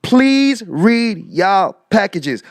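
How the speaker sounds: "Please read y'all packages" slow, each word separated; deliberate word-by-word stress on "Please read y'all packages"; emphatic quote. Retold or imitated. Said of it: "These words".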